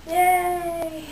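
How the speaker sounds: high cheerful yay; a quick bright cheer; little cheer. Acted. Host cheering herself on to viewers. Cheery show intro call.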